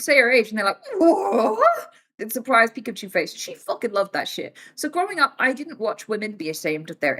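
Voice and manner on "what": much higher, louder with a laugh; stunned what. Retold or imitated. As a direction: squeal the word in disbelief. Strangers shocked at her age.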